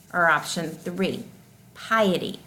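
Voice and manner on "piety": usual speaking voice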